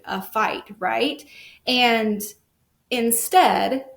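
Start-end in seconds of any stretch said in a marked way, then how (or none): none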